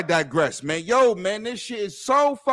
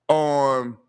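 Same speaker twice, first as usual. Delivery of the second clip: ordinary delivery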